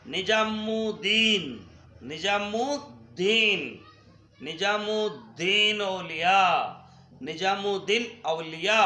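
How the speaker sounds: slow, drawn-out "निजामुद्दीन औलिया", syllable by syllable; careful pronunciation. Shown as a demonstration. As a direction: model "निजामुद्दीन औलिया" slowly for students to repeat. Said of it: "शब्दों का शुद्ध उच्चारण कीजिए".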